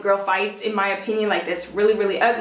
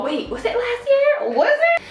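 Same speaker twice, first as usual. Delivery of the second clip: very high, sarcastic fake wondering; hammed-up squeaky disbelief; mock questioning; 13 semitones higher than usual. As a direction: pitch way up, pretend to just remember.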